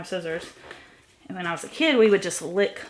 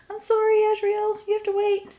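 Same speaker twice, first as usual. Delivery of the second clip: much higher, sweet talk to the cat; high pet voice; 13 semitones higher, 3 dB louder than usual. Real, not acted. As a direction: coo at the cat through the door. Talking to her cat at door.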